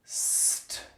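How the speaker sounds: st said as s then t; st, s and t apart; isolated st. Shown as a demonstration. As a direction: say st as two sounds. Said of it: "pronounce it individually".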